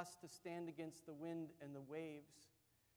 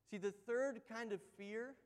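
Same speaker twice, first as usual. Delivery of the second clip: ordinary delivery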